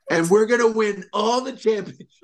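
high, boastful Trump promise; Trump impression. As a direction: Trump bragging about winning championships. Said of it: "He goes".

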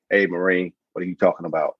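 dropped low and stern. Retold or imitated.

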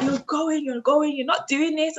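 imitating a cousin's high insistence; mock shout. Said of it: "And she was like".

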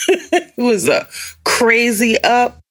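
high, laughing through words. Real, not acted. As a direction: giggle through the line. Laughs at own wordplay.

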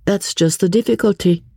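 high, animated society voice; lively lady, rueful. Acted. Talk of a romantic love match, high and animated. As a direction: exclaim lightly in a high register.

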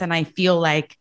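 ordinary talk, nothing marked

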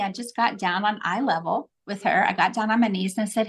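normal talking voice

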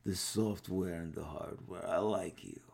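put-on a lighter take on a thick foreign accent; Avi Arad heavy accent. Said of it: "but he's like".

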